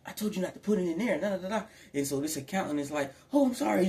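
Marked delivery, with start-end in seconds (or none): 0.1-1.6 mock snap. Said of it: "this person could have snapped". 3.3-3.9 higher, louder flustered sorry; panicked apology. Retold or imitated. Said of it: "now they panicking".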